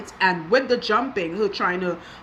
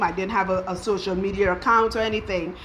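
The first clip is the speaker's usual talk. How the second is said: ordinary talk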